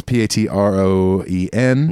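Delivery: spelling it out slowly; letter by letter, careful. Real, not acted. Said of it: "No, that was wrong".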